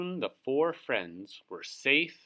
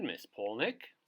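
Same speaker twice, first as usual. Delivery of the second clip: ordinary delivery, nothing marked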